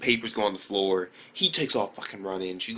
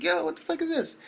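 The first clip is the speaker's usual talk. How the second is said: much higher, stunned outcry; shocked reaction. Retold or imitated. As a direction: blurt it in disbelief. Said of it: "I was like".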